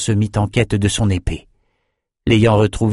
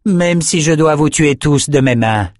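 high and loud, vowing to kill; a fierce vow shouted across the fight; furious warrior woman's threat; flung threat; 7 semitones higher, 4 dB louder than usual. A narrator's reading. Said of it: "lança-t-elle".

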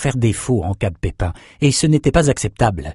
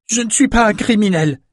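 angry outburst, much higher; exploding; 11 semitones higher than usual. Acted. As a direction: blurt it out in sudden fury. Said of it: "explosa Bannon". (éclat de colère, voix bien plus aiguë)

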